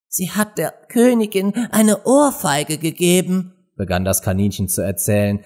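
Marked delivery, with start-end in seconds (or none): 0.1-3.5 high-voiced little rabbit. A narrator's reading. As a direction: confide gossip as a high-pitched rabbit. Nervous rabbit confides gossip. (hohe Kaninchenstimme)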